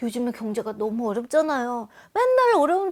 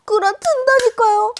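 exclaiming loudly; 7 semitones higher, 6 dB louder than usual. Acted. Exclaiming how people shrink.

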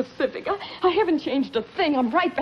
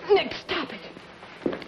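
breathy pleading whisper; 5 semitones higher, 4 dB quieter, much slower than usual. Acted. She pleads with scarred Vince.

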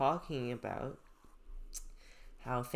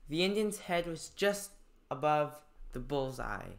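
clear, louder classroom reading of a passage; reading aloud; 2 semitones higher, 5 dB louder than usual. Acted. Teacher reading a story passage to students, clear read-aloud.

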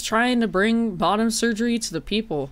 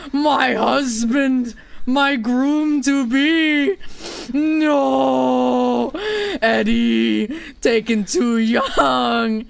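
loud, drawn-out melodramatic wail; hammed-up grief wailing; 6 semitones higher, 4 dB louder, much slower than usual. Mourning the husband taken too young, hammed-up wailing. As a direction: bawl like a bereaved spouse, wildly overacted.

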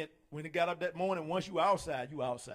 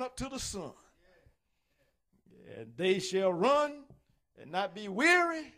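slow, loud preached reading; preaching scripture; 5 dB louder, slower than usual. Acted. Preacher reading Isaiah to the congregation, slow and loud. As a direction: lift the verse up slowly.

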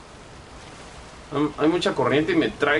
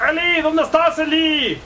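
calling out; 12 semitones higher, slower than usual. Real, not acted. Calling for Eli in game.

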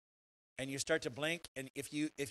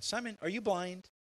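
sharp, raised sarcastic question; mock sarcastic jab; 8 semitones higher, 5 dB louder than usual. Shown as a demonstration. Jesus is not sneering at Simon like this. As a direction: needle Simon mockingly. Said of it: "he's not asking Simon".

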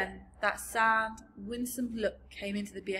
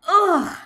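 loud, high "ugh" of revulsion; explosive yuck; disgusted splutter; 10 semitones higher, 16 dB louder than usual. A narrator's reading. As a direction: splutter out a big disgusted cry. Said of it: "she spluttered".

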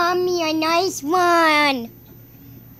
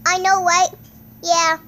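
usual speaking voice

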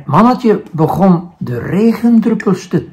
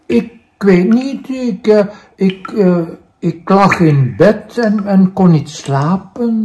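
higher, stammering and flustered; the little man answers the raindrop, stammering and higher; startled little man; 3 semitones higher than usual. Acted. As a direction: stumble over the words, caught off guard.

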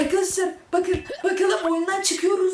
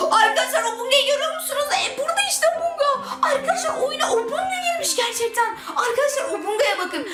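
high, fast, giggling; thrilled, laughing cries; excited squeal; 9 semitones higher than usual. Real, not acted. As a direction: show off the monster in delight. Obunga appears in the game.

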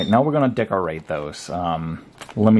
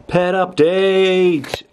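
announcer voice; 9 semitones higher, 4 dB louder, much slower than usual. Pretend. Announcing the pet segment.

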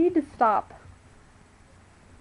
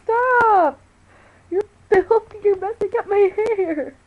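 higher, louder whining cry; whiny yell; 7 semitones higher, 10 dB louder, slower than usual. Real, not acted. Owner wails at her dog to stop.

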